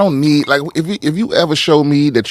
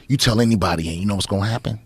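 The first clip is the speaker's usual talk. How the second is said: low, softer imitated threat; abusive parent threat; 7 semitones lower, 6 dB quieter than usual. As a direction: lightly voice a menacing abuser, lowered and quiet. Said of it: "just an abusive parent or anybody".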